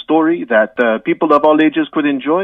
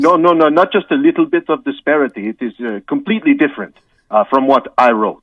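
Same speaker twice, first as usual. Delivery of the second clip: hammed-up French accent, halting with uhs; 3 semitones higher than usual. Guest apologises to the host in a mock French accent. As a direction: play a comic Frenchman stumbling through English.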